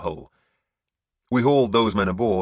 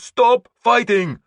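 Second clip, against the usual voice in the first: loud, much higher, slow commanding shout; 11 dB louder, much slower than usual. A narrator's reading. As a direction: stretch each word out over the fight. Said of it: "my turn to shout".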